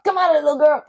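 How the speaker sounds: high-pitched imitation of his holler; mock yelling. Retelling the man outside the door, mock yelling. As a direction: mimic the man calling her out. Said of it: "This man just yelling".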